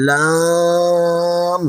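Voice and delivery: লাম drawn out slowly and gently; gentle long লাম in recitation; soft Lam. Shown as a demonstration. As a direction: model লাম lightly for students to repeat. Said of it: "আমার সাথে আলতো করে পড়ুন".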